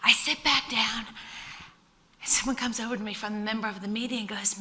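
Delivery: ordinary delivery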